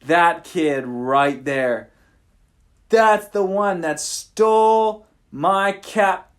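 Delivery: hammed-up pirate king accusation; pirate king accusing. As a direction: drag out each word like a pointing finger.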